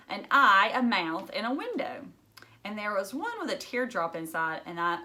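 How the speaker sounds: ordinary delivery, nothing marked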